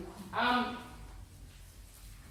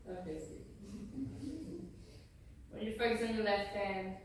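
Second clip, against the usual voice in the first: guided meditation; 8 dB quieter than usual. Acted. Leading everybody breathing in pink light, soft guided meditation. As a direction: ease the group into calm breaths, softer.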